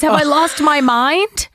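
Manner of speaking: mild mock distress; worried confusion. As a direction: fret that your mind is slipping. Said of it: "And she goes".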